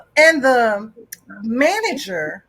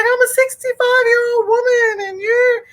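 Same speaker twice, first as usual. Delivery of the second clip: put-on outraged old woman; 8 semitones higher than usual. Arrested woman protests to the cop in a high indignant voice. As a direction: play her as a shocked, offended elder.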